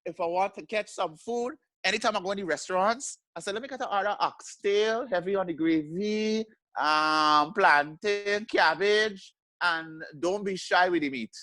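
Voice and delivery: put-on high, playful Guyanese accent. Ordering oxtail at a restaurant in Guyanese accent. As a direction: order food like a Guyanese auntie. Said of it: "Guyanese woman".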